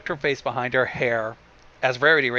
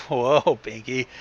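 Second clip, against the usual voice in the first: usual speaking voice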